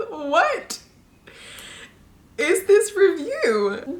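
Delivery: can't believe this review; incredulous. Real, not acted.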